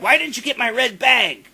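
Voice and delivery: high, loud mock accusation; blaming. Mocking the singer blaming him for the bag, high and loud. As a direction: shriek the blame like a diva. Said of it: "she starts blaming me".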